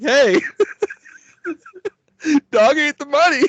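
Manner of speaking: high, laughing, mock-innocent excuse; pleading with the mob guys, giggling through the excuse; sheepish excuse. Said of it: "and I'm like".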